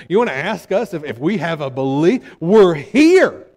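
loud, high, offended protest building up; indignant fishermen. Retold or imitated. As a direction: defend yourselves, outraged at the question. Offended fishermen answering back indignantly.

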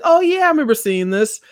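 bright high-pitched oh yeah; mock delighted recognition; high excited recognition. Quoting himself to the mom, high and excited.

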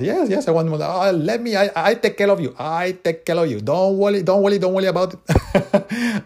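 high-pitched Chinese accent imitation, ending in a laugh; the Chinese lady reassuring him in her Chinese accent; imitated Chinese accent, high and cheerful. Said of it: "I'm gonna try to do the accent here".

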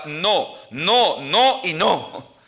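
each no slow and punched; slow repeated no, no, no y no, each one hit hard; stressed no. Shown as a demonstration. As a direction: hammer every no, drawn out. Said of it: "lo que quiero enfatizar es esa palabra no".

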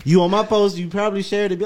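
ordinary talk

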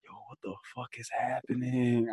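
softly mumbled, groggy disbelief; half-asleep bewilderment. Retold or imitated. Watching neighbours argue while half asleep, groggy and baffled. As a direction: murmur it like you've just been woken up. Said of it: "half asleep too".

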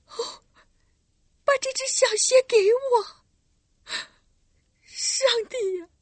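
high, fast, tearful outburst; trembling cry. Acted. Girl begs for the little shoe, trembling cries. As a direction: cry out to God, shaking. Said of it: "哆嗦着说道".

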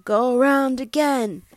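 a first, restrained storybook yell; mock yelling. Parent voices the yelling dog for child, mild mock yell. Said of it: "there's one dog yelling".

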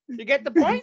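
pushy repeat. Shown as a demonstration. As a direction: push it harder the second time.

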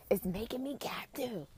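whispered repeat. Acted. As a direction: hush it like a secret.

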